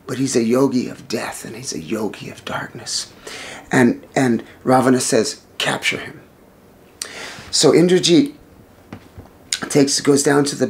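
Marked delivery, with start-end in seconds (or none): 0.1-3.1 hushed, lower whisper; storyteller's eerie whisper; ominous whisper. A narrator's reading. Ominous description of dark yogi. 5.6-6.2 menacing whisper; a king's whispered command to capture; whispered order. Acted. King gives quiet command.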